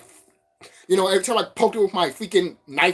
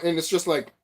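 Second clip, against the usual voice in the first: ordinary talk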